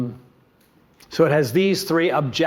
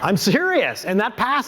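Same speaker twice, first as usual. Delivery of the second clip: ordinary talk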